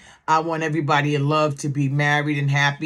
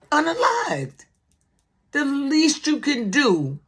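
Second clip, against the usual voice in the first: crying out; 9 semitones higher than usual. Real, not acted. Emotional outburst about a death.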